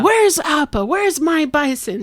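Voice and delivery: high-pitched angry demand; pissed off. Retold or imitated. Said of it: "Aang gets pissed".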